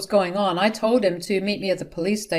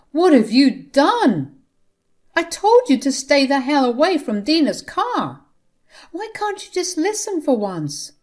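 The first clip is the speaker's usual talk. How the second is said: angry outburst; 8 semitones higher, 6 dB louder than usual. A narrator's reading. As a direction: hammer each question at her. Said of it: "never talked to his mother in this tone".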